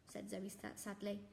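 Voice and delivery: ordinary delivery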